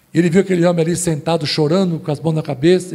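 ordinary talk

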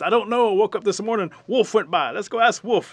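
high, loud, excited squirrel; frantic squirrel. Acted. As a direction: shout the answer while on the run. Squirrel answers on the run.

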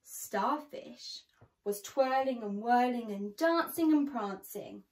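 ordinary delivery, nothing marked

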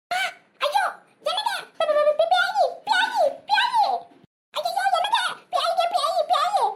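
high, stammering cry of terror; panicked screaming. Acted. Mother screams for husband after seeing son's ghost, panicked. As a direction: shriek in panic, tripping over the word. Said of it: "இப்படி கத்திட்டு உள்ள ஓடிட்டாங்களே".

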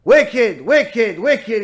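loud, repeated parrot accusation; scolding parrot. Acted. Parrot rebukes the wife, loud mock scolding. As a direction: chant it at her like a parrot, punching each word. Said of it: "he tells the woman".

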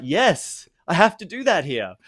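lightly voiced Brisbane man confessing; resigned Aussie friend. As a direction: admit it with a shrug. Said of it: "He's like".

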